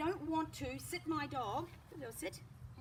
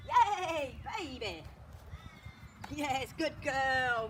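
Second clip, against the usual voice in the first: excited praise; 5 dB louder, much slower than usual. Real, not acted. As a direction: cheer the puppy on. Praising dog after release.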